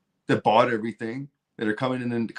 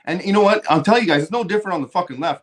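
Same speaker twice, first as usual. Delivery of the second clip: higher and louder, fast fired-up rant; worked-up ranter telling viewers the left is no different; heated outburst; 10 semitones higher, 5 dB louder, faster than usual. Real, not acted. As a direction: call it out to the crowd, worked up.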